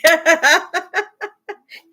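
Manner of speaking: loud high laugh. Real, not acted. After voicing the cat, loud high laugh. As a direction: laugh at your own joke.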